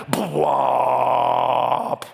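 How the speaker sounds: loud, long held "bwomp"; big cinematic bwomp, drawn out; movie boom imitation. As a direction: boom out a trailer sting with your voice.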